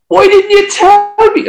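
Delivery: very high, loud outburst; retold scream. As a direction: shriek it at your bandmate in disbelief. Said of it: "I screamed at him".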